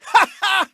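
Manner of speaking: loud, high victory cackle; loud high "ha ha" of a show-off winner; triumphant laugh. Acted. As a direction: crow over the win. Grabbing the win, triumphant.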